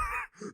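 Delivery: short high real laugh; high burst of laughter. Real, not acted. Narrator laughing at the thrown Venom, short high burst. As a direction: let a squeak of laughter escape.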